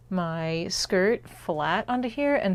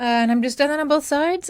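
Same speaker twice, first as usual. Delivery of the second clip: ordinary delivery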